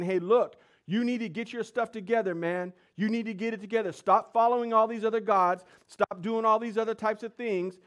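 loud, high urgent scolding; acted rebuke. Elijah calls the nation to repentance, forceful rebuke. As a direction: preach it as a forceful telling-off.

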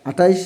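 "আটাইশ" with an extra i-glide before the final sh; common mispronunciation. Shown as a demonstration. Teacher citing children's frequent error, mispronounced. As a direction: say "আটাইশ" with ai in the second syllable. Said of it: "এই সংখ্যাগুলোর উচ্চারণের ক্ষেত্রে ... সমস্যাটি".

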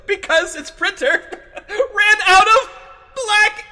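barely holding back the laughter; laughing. Acted. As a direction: fight through the laughter to get it out. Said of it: "Stop laughing!".

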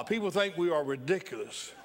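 ordinary talk, nothing marked

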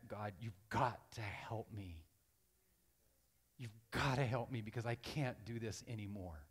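slow, restrained cry for help to God; upset plea. Retold or imitated. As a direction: retell a desperate prayer, drawn out. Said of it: "I was half angry. I was upset".

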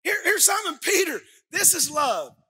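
full-force preaching. Acted. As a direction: preach it out to the congregation. Preaching climax.

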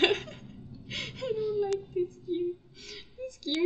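slow laughing whimper; laugh-whine. Real, not acted. Player laugh-whining at the mean game.

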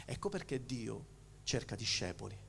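normal talking voice

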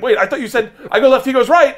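high, loud panicked blurt; panicked protest. Retold or imitated. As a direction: protest frantically as the bull comes. Panicking as the bull charges.

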